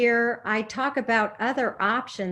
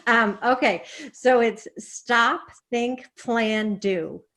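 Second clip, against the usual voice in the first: ordinary talk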